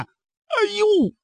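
sudden high yelp of alarm; a short, high cry of dismay; startled cry. Acted. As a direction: cry out in shock at the sight. Brothers see the hero win.

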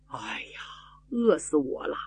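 faint, breathless whisper of a starving man; weak starving whisper. Acted. Starving soldier, just fed, whispers feebly to his rescuers. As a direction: barely get the words out, half dead from hunger.

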